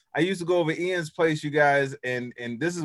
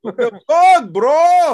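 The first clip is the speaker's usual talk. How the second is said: mock outburst; 10 semitones higher, 13 dB louder, much slower than usual. Outburst during banter, likely accent.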